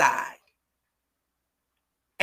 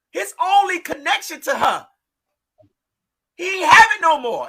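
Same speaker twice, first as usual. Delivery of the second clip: outraged shout; 6 semitones higher than usual. Real, not acted. Grieving mother blaming the families, outraged shout. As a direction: hurl the blame at them. Mother accusing over daughter's death.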